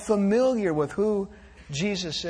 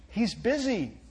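put-on softer hushed child's voice; child's whisper; 2 semitones higher, 5 dB quieter than usual. A narrator's reading. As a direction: whisper it matter-of-factly. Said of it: "whispered the child".

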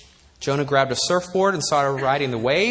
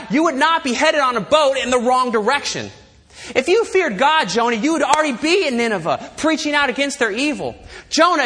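louder, higher rebuke of Jonah; preaching rebuke; 6 semitones higher, 4 dB louder than usual. Acted. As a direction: thunder it at Jonah from the pulpit. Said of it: "I want to say back to Jonah".

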